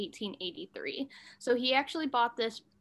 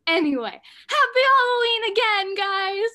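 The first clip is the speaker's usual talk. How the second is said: excited; 10 semitones higher, 8 dB louder than usual. Real, not acted. Repeats the Halloween greeting.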